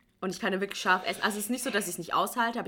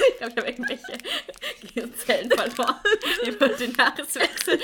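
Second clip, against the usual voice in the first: laughing; 6 semitones higher, 6 dB louder than usual. Real, not acted. Laughs at her own forgetfulness.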